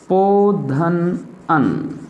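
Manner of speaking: each syllable drawn out very slowly for the class to write; slow dictation. Shown as a demonstration. Teacher dictating the answer to children, very slow. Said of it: "इसका संधि विच्छेद होगा बच्चों".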